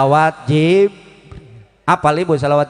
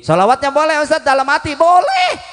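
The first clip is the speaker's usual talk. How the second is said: high-pitched, raised-voice question; mock congregant; 11 semitones higher, faster than usual. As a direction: call out high like an eager questioner. Acts out congregant asking the preacher.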